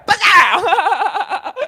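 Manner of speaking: loud high laughing yell; punch shout. Retold or imitated. Reenacting the brawl punch.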